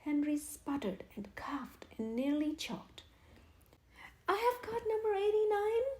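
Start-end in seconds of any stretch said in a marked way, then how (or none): 4.3-5.9 much higher, loud delighted shout; happy cry. A narrator's reading. Henry finds he holds the winning ticket, crying happily. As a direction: burst out with joy. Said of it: "he cried happily".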